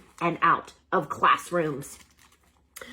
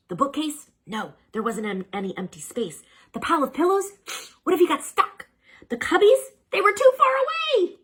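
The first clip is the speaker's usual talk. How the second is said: higher and louder, rushing panic; frantic; 10 semitones higher, 4 dB louder than usual. A narrator's reading. Hamster frantically searches for a hiding place. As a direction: scramble for a hiding spot.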